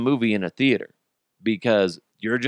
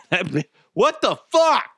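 outraged; 9 semitones higher than usual. Real, not acted. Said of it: "outraged at wife".

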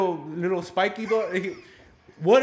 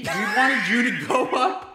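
laughing retort; 5 semitones higher, 5 dB louder than usual. Retold or imitated. Friends laughing at his booger.

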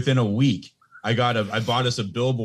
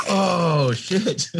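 higher, startled mock dismay; caught off guard; 8 semitones higher than usual. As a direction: react like the kid just embarrassed you. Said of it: "And I'm like".

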